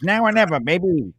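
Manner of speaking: a lightly voiced coach, pitch up on the first words; a mild imitation of the coach saying it; Woody impression. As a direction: say it the way Woody would, half-joking. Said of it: "That's how Woody sounds".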